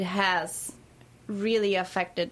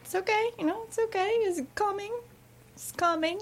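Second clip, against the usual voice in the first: self-reassuring; 11 semitones higher, faster than usual. Retold or imitated. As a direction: coax yourself gently that it's coming. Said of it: "feeling that I'm like".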